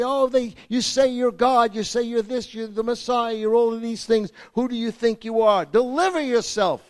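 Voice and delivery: lightly voiced mocking crowd. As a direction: taunt him like the crowd. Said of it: "they yelled at him on the cross".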